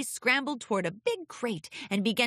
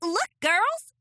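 high excited filly shout; 10 semitones higher, 5 dB louder than usual. Acted. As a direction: call out like an excited young pony. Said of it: "shouted Apple Bloom".

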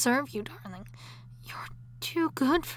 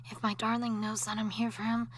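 ordinary delivery

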